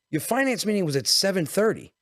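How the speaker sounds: high-pitched suspicious wife; accusing wife. Retold or imitated. Retold wife catching him out.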